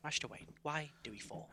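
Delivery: very soft imitation of the actor; Michael Caine. Host as butler to Master Wayne, very quiet impression. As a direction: murmur the bad impression. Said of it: "as Michael Caine".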